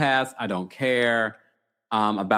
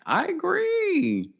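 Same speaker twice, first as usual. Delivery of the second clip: drawn-out agree; much slower than usual. Real, not acted. Strong agreement with commenter.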